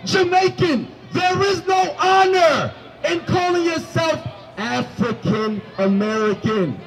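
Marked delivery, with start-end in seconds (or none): none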